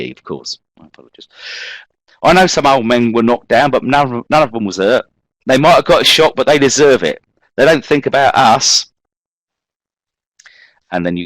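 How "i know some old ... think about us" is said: put-on raised, louder London youth accent; defiant young Londoner, brushing it off. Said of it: "I'm imagining this, uh, that this is how this gentleman spoke".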